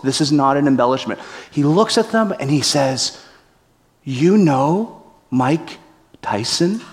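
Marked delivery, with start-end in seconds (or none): 4.0-6.8 slow, wide-eyed and earnest; sincere innocent question. Retold or imitated. Brian asking the threatening guards, slow and sincere. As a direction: ask it with total innocence. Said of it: "With sincerity in his eyes".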